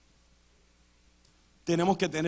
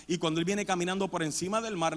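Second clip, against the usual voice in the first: usual speaking voice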